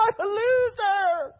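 high whimpering mock sob; hammed-up tearful whimper; mock crying. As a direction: whine it like a crying little girl. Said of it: "crying like a little girl".

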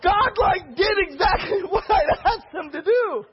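giddy, high, near-tearful shout; laughing in disbelief. Retold or imitated. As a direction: shout it through a laugh of amazement. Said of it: "I was like".

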